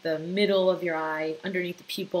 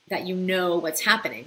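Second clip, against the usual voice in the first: ordinary talk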